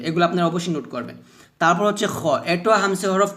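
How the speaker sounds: ordinary talk, nothing marked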